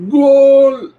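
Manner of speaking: huge high soccer announcer yell; Telemundo goal call. Pretend. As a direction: belt it out like a Spanish sports announcer. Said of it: "Just do the Telemundo voice".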